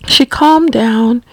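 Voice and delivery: plain reading voice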